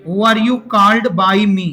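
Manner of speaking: very slow, word by word; slow model sentence. Shown as a demonstration. Teacher to his class, dictating the answer very slowly. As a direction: drag each word out for repeating. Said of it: "तो क्या होगा?".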